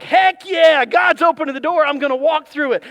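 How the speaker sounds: loud, eager cheer; hammed-up thrill at the open door; mock excited. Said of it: "you're like".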